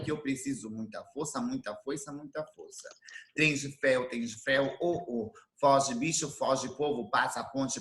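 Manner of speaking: cantarolado no ritmo do trem; declamação ritmada. Acted. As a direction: marcar o compasso como um trem em movimento. Recites Trem de Ferro rhythmically. (declamando Trem de Ferro em voz baixa e cadenciada)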